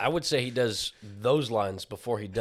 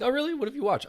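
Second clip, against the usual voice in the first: high mock interviewer; faster than usual. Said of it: "they're like".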